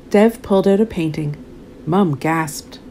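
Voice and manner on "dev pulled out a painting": ordinary delivery, nothing marked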